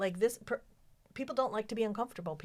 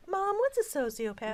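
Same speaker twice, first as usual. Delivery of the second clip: put-on higher, innocent kid voice; mock child question; 11 semitones higher, 6 dB louder than usual. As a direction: play the puzzled kid for the laugh. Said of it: "kid voice joke".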